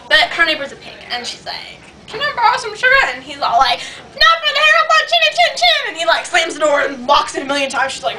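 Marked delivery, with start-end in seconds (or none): 2.1-2.9 high put-on voice of a friend; imitating friend's voice. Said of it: "She really talks like this". 3.8-5.9 very high cartoon pig refusal; mock pig voice. Pig refuses wolf at door.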